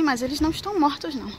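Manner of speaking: ordinary delivery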